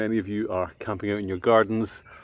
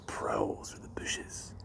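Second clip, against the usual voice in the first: whisper; 12 semitones lower, 11 dB quieter, much slower than usual. A narrator's reading. Fox prowling at night.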